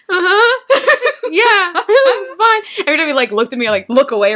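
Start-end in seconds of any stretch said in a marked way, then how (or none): 0.1-2.9 high, strained nervous answers; tense. Retold or imitated. Answering the scary man while tense, high and strained. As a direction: squeak out tense replies, slow at the end. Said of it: "I'm like, tense".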